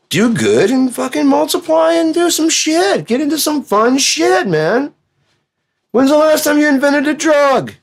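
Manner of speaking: very high, excited party God; hyped God. Retold or imitated. God urging humanity to party, very high and excited. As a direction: rev them up like a manic party host.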